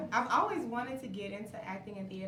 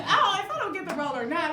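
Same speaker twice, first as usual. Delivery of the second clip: higher, louder laughing vow; excited; 7 semitones higher, 11 dB louder than usual. Retold or imitated. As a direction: bubble over with excitement. Excited retelling own vow.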